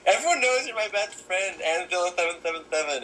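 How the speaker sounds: high, lisping mock voice; mocking quoted commenter. Imitating commenters on her best-friend shout-outs, mocking impression. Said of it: "They're like".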